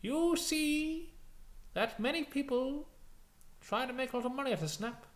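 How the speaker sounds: high, formal British dealer; put-on professional British voice; Chegwin. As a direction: explain loftily like an affected croupier. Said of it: "he's put on the voice to sound more professional".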